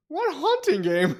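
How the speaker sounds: squeaky high puzzled ask; high confused question. Retold or imitated. Mishearing hunting as haunting, high puzzled question. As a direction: pitch it way up, baffled. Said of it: "and I'm like".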